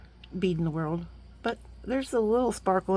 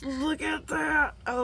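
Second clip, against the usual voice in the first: excited; 8 semitones higher, 4 dB quieter, slower than usual. Real, not acted. Marveling at beads.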